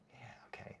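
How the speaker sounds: whispered aside. Real, not acted. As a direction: mutter it under your breath. Aside reacting to Warcraft comment.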